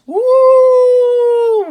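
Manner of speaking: long, high, loud ghost hoot; ghostly woo. Acted. Teacher makes the ghost's sound for the kids, high and loud.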